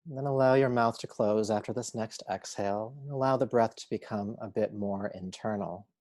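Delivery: measured yoga nidra guide voice; unhurried, steady meditation instruction; guided meditation. Acted. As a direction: guide them calmly, a phrase at a time with long pauses.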